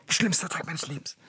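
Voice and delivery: soft, dread-filled whisper; dramatic whisper. Acted. Boy whose father is being called, dramatic whisper. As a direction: whisper it as if doomed.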